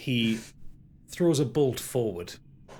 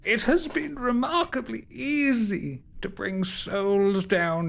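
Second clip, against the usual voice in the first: high, put-on old man's voice; villain as old man; 9 semitones higher, slower than usual. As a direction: gloat in a high, eerie voice. Game master voices Derethor as old man.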